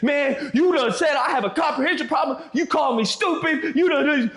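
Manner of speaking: hammed-up indignant shout; mock upset Keith. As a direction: play the offended guy. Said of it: "Keith starts getting upset".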